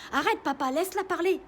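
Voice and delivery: high, loud protest; angry demand. Acted. Sister yelling at father to let Juliette speak. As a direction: push back at your father hard.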